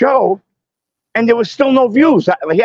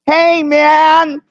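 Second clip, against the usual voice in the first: a higher, drawn-out "Hey, man" in his voice; easy laid-back greeting, pitched up; Schumacher impression; 8 semitones higher, 3 dB louder than usual. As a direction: do your best Schumacher. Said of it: "[name]'s got the best Schumacher impression".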